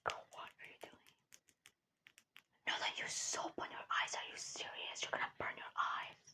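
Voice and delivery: put-on whispered popular mean girl; hushed, scolding whisper to a crying nerd; whispered roleplay. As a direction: whisper it like a bossy, condescending classmate.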